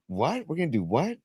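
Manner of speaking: re-enacted double take, pitch jumping up; incredulous. Guest retelling the backstage invitation, incredulous.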